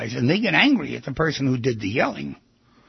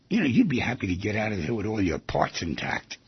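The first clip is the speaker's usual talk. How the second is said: usual speaking voice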